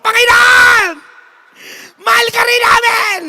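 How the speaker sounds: very high, loud crying shout; crowd wailing shout. Acted. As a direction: scream your devotion through tears. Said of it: "Sigawan yung mga tao".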